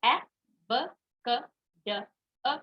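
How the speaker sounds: clipped short phonic sounds ए, ब, क, ड, अ, each apart; short half sounds. Shown as a demonstration. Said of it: "मैं आधा sound ही बोल रही हूँ". (ए, ब, क, ड, अ को छोटी, आधी आवाज़ में बोलना)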